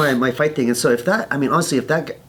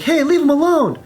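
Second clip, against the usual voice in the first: high, louder retold challenge; calling out; 5 dB louder than usual. Bystander stepping in on a fight, calling out. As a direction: shout over to break it up, acting it out.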